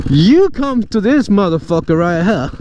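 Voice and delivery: ordinary talk, nothing marked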